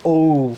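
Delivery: long loud "ও" from inside the throat. Shown as a demonstration. Teacher demonstrating letter O, long "ও" from the throat. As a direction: bring "ও" up like a burp. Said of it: "গলার ভিতর থেকে আসবে".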